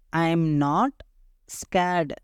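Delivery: "I am not ... scared", long pause before scared; slow, split for repeating; say-along drill. Shown as a demonstration. Teacher leading learners to say it along, slowly in parts. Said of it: "என் கூட சேர்ந்து சொல்லுங்க".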